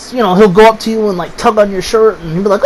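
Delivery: ordinary talk, nothing marked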